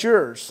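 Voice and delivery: "yours" said as "yers"; Southern "yers". Shown as a demonstration. Pastor giving the Southerner's commandment, "yours" as folksy "yers". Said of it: "That's Y E R S there".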